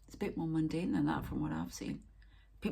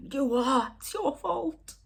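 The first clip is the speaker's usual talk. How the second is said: high, raised-voice accusation; retold accusing shout; 9 semitones higher, 4 dB louder, slower than usual. Woman retelling her row with the spirits, high accusing shout. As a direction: hurl the blame high and loud. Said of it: "you'd be shouting and screaming at them".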